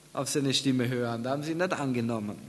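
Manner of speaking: ordinary delivery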